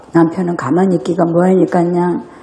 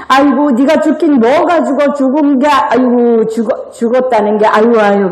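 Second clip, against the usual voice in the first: higher, mocking sigh of a fed-up spouse; mock spouse bickering; 6 semitones higher than usual. Sneering at a quarreling spouse, mock exasperation. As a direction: scoff at your partner's claim. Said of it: "막 서로 싸우는 거야".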